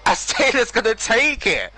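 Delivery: thrilled commentator bellow; excited call. Real, not acted. Last-lap drama being called.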